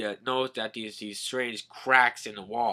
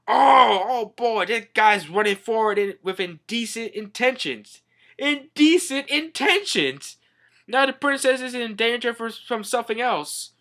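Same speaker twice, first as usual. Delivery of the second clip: high, raised comic alarm; mock scandalised; 9 semitones higher, 5 dB louder than usual. Minister sees skeleton run off indecently, mock scandalised. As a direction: cry out in exaggerated outrage.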